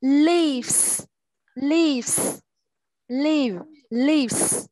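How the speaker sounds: lives with a crisp final s, set against live; drilling lives. Shown as a demonstration. Teacher drills lives against live for the class, clearly. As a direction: repeat lives and live, marking the s. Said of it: "He này chúng ta phải thêm S nha".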